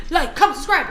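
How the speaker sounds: laughing. Real, not acted. Joking outro, laughing.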